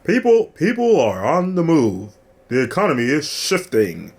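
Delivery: slow, high old-time announcer imitation; 1950s radio voice. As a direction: imitate a fifties newsreel narrator. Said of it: "That's that, like, nineteen fifties radio voice".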